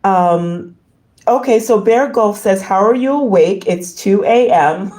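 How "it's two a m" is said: normal talking voice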